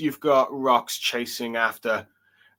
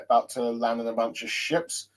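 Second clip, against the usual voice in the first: usual speaking voice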